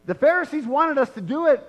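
hammed-up excuse from a sinner; mock excuse-making. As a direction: play the crowd dodging blame. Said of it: "there's not one of them that said".

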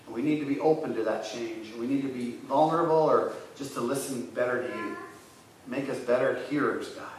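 soft, earnest prayer voice. Real, not acted. Pastor praying softly for the lonely. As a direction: pray quietly to God for the lonely.

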